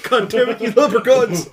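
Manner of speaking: mock angry shout. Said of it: "bank account empty".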